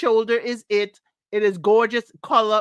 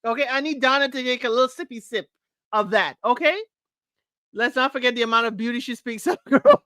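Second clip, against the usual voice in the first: ordinary talk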